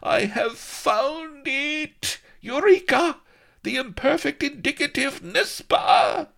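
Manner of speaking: very high, loud, slow triumphant shriek; very high ecstatic shout; exultant. A narrator's reading. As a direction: scream it out in triumph. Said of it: "an exultant scream of laughter".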